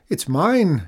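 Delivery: high, loud counterclaim; shouting. A narrator's reading. Farmer disputes his neighbour's claim to the moon, shouting. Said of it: "shouted Nagel".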